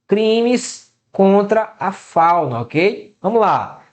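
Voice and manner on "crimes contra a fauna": very slow, drawn-out words; teacher dwelling on a heading; slow emphatic heading. Shown as a demonstration. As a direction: announce the topic word by word, stretching each. Teacher slowly repeats section heading.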